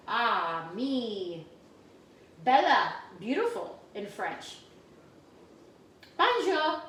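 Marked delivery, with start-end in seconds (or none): 0.1-1.5 "Ah, me" said as French ami, ah-MEE with stress on the end; French pronunciation. Shown as a demonstration. Teacher showing kids how to say ami in French. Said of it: "You say it like". 6.2-6.8 "Bonjour" said bright and high the French way, bon-ZHOOR; French pronunciation. Shown as a demonstration. Teacher modeling the French word for her class, bright. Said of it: "hello in French".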